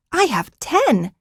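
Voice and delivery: put-on high, slow indignant child exclaim; girl correcting her grandfather. A narrator's reading. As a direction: insist proudly on it. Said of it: "Joranna exclaimed".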